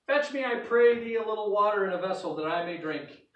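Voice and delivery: loud, high call of the prophet; Elijah calling out. Acted. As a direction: call out boldly across the field. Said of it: "And he called to her and said".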